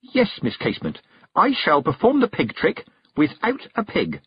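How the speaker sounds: resolute. A narrator's reading. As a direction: announce a feat to the crowd. Defiant announcement to the hostess.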